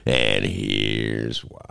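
slowed, deep spacey announcer voice; trippy announcer. Acted. Host hyping the show in a trippy voice. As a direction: drag it out low and woozy. Trippy friday intro hype.

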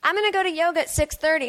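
very high woo, fast boast; drunk excitement. Pretend. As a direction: whoop it like a tipsy reveler.

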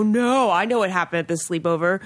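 ordinary talk, nothing marked

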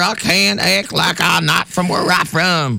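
hammed-up Southern accent, pitched high; Hannah. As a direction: play a worn-down Southerner who gave up hiding the accent. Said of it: "doesn't have a thick accent".

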